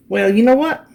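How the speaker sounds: ordinary delivery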